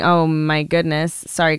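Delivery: normal talking voice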